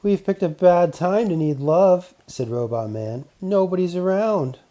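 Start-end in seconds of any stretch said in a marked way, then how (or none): 0.0-2.1 robot man voice. Acted. Robot Man speaks in story. 3.4-4.6 robot man voice. Acted. Robot Man speaks in story.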